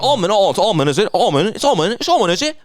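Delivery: high, excitable mock-Scottish voice; Scottish accent. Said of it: "the Scottish one. He was like".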